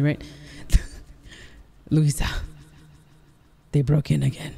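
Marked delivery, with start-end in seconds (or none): 1.9-4.5 full-level take through voice effect; dramatic game line with voice effect; voice effect test. Shown as a demonstration. Streamer asks viewers if this one is good, voice effect. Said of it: "is this one good".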